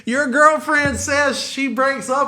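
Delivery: high, slow yell without full volume; mock distant yell. Youth yells up to the boyfriend on the chairlift, mock holler. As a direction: imitate calling news up from far below. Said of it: "Yells it from the snow".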